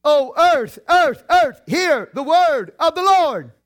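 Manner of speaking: high, loud scripture cry; emphatic proclamation. Acted. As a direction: punch out each word high, stretching the end. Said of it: "you have a plurality because of emphasis".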